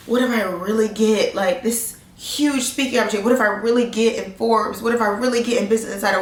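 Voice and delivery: usual speaking voice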